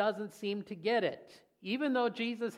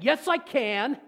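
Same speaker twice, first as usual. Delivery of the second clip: put-on high, loud childish insistence; stubborn kid answering back; a child refusing to take no; like a little kid; 6 semitones higher, 9 dB louder, slower than usual. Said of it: "Just like a little kid".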